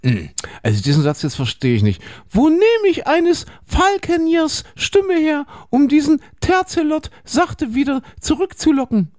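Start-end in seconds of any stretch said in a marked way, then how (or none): 2.3-9.1 lightly voiced Juliet. Reads Juliet's line.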